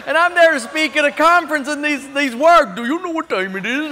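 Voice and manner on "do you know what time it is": put-on Indian accent. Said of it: "East Indian accent".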